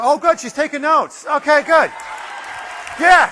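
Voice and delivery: loud, high, delighted cheering; excited praise. Real, not acted. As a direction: cheer her on loudly from across the room. Cheering an audience member on.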